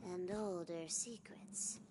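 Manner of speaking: breathy secret whisper. A narrator's reading. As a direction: hush it like a secret. Narrator whispers scene.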